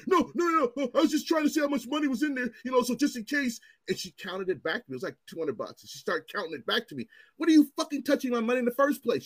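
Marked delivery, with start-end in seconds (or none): 0.1-3.6 high, hurried imitation of a flustered officer; flustered backpedalling. Officer backpedals after accusation. 7.4-9.2 high, heated retold demand; indignant challenge. Retold screaming at the officer.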